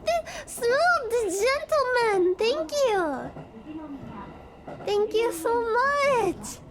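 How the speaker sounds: high, drawn-out cheer of gratitude; slow, high, delighted shout-out; excited thanks. Real, not acted. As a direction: stretch the thanks out, thrilled. Thanking viewer for gifted subs.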